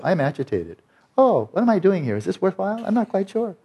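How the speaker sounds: high, pleading voice of a needy mind; mock the craving mind in a high whine; whiny inner voice. Voicing the grasping, restless mind.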